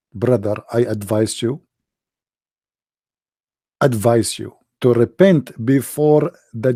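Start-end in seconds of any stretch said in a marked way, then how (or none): none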